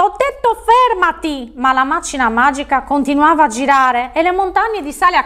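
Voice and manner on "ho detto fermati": high, drawn-out angry command; furious cry. A narrator's reading. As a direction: insist furiously, stretching the words up high. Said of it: "gridò infuriato". (ordine infuriato, acuto)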